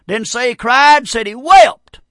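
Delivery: WEPT punched high and loud; preacher hammering the word "wept"; emphatic stress. Real, not acted. Preacher insists on the Bible's word.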